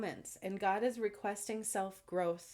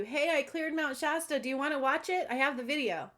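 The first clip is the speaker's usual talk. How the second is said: higher and louder, a keen sales pitch; eager pitch; 7 semitones higher, 5 dB louder than usual. Retold or imitated. Telling viewers about her video, keen and higher. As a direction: pitch it to a stranger with eager cheer. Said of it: "I will literally tell you".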